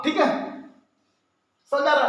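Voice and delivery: ordinary talk, nothing marked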